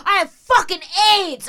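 high, loud threatening yell; mock menacing shout. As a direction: hurl it like a threat.

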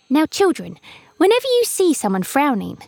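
lightly voiced mother bird. A narrator's reading. Mother bird's voice.

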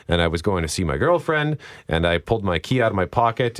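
normal talking voice

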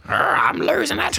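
high, stretched-out footy player voice; a high mock wail of losing it; Husey impression. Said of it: "Do an impression of Husey".

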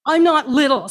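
put-on sharp, loud childish snap; a blind girl snaps at the grown-ups talking about her, loud; snapped. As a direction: bite back hard at being called little. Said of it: "snapped Larissa".

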